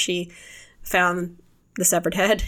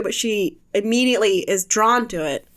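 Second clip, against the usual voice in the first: ordinary talk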